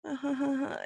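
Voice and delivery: subdued, airy half laugh; laugh without the eyes. Shown as a demonstration. Showing eyes that aren't really laughing, hollow airy laugh. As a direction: let the laugh go hollow and empty. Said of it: "but their eyes might go".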